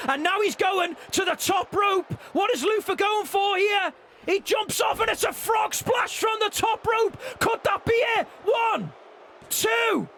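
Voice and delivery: high, shouted title-win call; wrestling commentator. Acted. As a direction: scream the finish like a ringside announcer. Live wrestling play-by-play.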